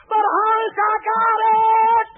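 high, loud, drawn-out insistence; ecstatic insisting. Retold or imitated. Wife insisting to her doubting husband, ecstatically. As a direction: plead it in rapture.

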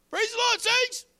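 very high, put-on mocking call; imitated passer-by's greeting. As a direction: mimic a passer-by in a squeaky high voice. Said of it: "we had a guy used to come".

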